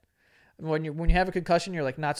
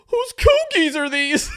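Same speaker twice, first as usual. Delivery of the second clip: high, loud, drawn-out fake bawl; hammed-up bewildered wail; mock wailing; 12 semitones higher, 8 dB louder, slower than usual. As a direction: imitate a kid's overblown amnesia panic. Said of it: "he starts crying".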